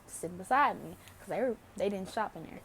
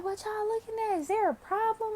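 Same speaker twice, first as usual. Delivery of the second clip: mocking the girls; 12 semitones higher than usual. Narrator mimicking the balcony girls calling down, high mock taunt. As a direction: imitate their snotty challenge. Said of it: "they was like".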